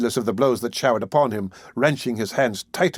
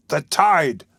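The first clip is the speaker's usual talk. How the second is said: murderer crying out; 4 semitones higher than usual. A narrator's reading. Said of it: "cried the murderer".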